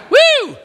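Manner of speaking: high, loud whoop of excitement; excited whoop. Real, not acted. Preacher whooping to the congregation, loud and high. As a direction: let out a joyful whoop. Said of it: "preacher whoops".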